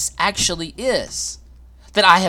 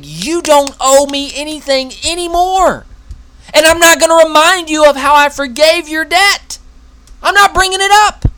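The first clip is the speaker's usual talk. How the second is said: much higher and louder, proclaiming release; forgiving declaration; 12 semitones higher, 7 dB louder than usual. Acted. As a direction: declare it boldly like a promise. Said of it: "Forgiveness is saying".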